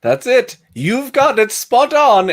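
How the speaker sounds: high-pitched put-on voice, thrilled at a correct guess; delighted exclamation. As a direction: squeal it in amused triumph. [name] delighted by the accent guess.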